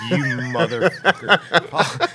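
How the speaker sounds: cracking up mid-curse, high and loud; laughing outrage. Retold or imitated. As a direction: laugh through the insult in disbelief. Laughing disbelief at medic's offer.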